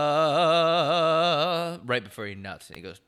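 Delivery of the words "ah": loud, high, sustained "ah"; hymn-like held wail; imagined priest's climax cry. Pretend. Imagining a priest at climax for a laugh, mock sung cry. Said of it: "What if the priest, every time he nuts".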